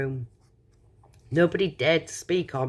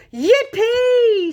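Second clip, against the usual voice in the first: high, loud, drawn-out cheer; jubilant shout; 7 dB louder than usual. A narrator's reading. Grandpa Joe dancing in victory, jubilant shout. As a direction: shout it long and high with joy.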